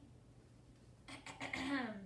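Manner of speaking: theatrical throat clearing. A narrator's reading. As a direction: clear your throat before announcing. Piggy prepares to speak.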